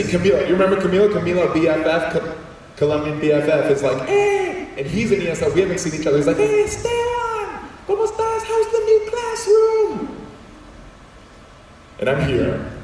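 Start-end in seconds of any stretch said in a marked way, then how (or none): 4.1-4.7 excited call. Retold or imitated. Camilo calls out to him. 6.4-10.2 much higher, slow, delighted call; light up at seeing an old friend; excited greeting. Retold or imitated. Colombian best friend greets him in the hall, overjoyed. Friend Camilo calls out cheerfully.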